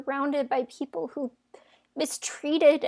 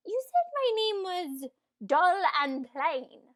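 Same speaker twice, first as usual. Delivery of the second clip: higher, affronted protest; indignant; 6 semitones higher than usual. Acted. Ella to her suitor about being called dull, indignant. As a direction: throw his insult back at him, pitched up. Offended at his description of her.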